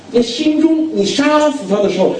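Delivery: a high preacher's exclamation; high emphatic preaching. Acted. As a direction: cry out the word 中 high, then let it drop. Preacher rising to a climax.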